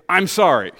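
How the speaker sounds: loud called-out apology; leading the room. Shown as a demonstration. Preacher leading the congregation in a loud sorry. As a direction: call it out for the whole room to repeat. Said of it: "turn to someone near you and say".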